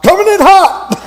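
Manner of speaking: high, loud shouted warning; yelled warning. Retold or imitated. Instructor in the tower warning of a fast rider, loud yell. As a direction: call it out loud as he comes in fast. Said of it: "he yells out".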